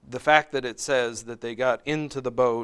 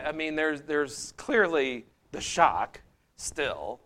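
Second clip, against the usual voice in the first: ordinary talk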